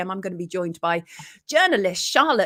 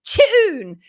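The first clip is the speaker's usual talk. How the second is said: loud, high-pitched holler; imitated shout; 10 semitones higher, 8 dB louder than usual. Host reading out a listener's comment as a playful shout. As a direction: shout out the typed comment in mock excitement. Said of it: "Oh, no need to shout".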